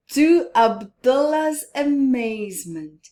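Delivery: plain reading voice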